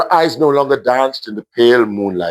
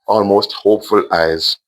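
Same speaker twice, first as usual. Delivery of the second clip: ordinary delivery, nothing marked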